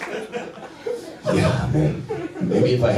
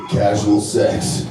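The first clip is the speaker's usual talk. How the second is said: slow deadpan; much slower than usual. Acted. Punchline delivered slowly.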